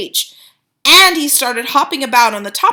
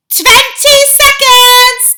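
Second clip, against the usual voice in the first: high, loud, slow witch countdown; witch crying out; 11 dB louder, much slower than usual. A narrator's reading. Grand High Witch counting down to the audience. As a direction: cry out the countdown gleefully. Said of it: "cried the Grand High Witch".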